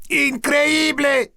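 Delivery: slow, high, ecstatic shout. A narrator's reading. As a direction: belt it out at the TV. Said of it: "he shouted".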